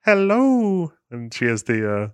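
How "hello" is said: put-on high, loud old nanny hello; Mrs. Doubtfire voice. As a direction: sing out a shrill-free high hello. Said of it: "That's when".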